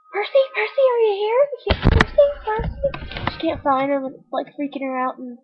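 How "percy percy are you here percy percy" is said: high-pitched searching call; Annabeth. Retold or imitated. Annabeth looking for missing Percy, calling out high. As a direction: call out high, stretching the last name.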